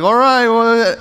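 high, reluctant little reply; mimicked uneasy kid, high voice; wary child. Wary child behind the mother.

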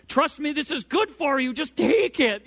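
high, strained, insistent parental pleading; escalating parent. Retold or imitated. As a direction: act out a parent losing patience with a child. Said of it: "escalate as well".

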